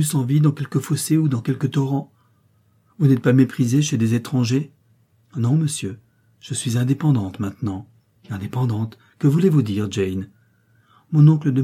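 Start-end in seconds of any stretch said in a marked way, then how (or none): none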